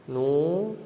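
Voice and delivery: "Nu" with a held long oo; teacher's slow model of "Nu"; demonstrated recitation. Shown as a demonstration. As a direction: draw out the vowel of "Nu". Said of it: "Sebenarnya kita baca begini".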